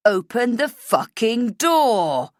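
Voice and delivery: furious shout. Acted. Killer with a bat at the locked door, roaring. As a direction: bellow it while smashing the door.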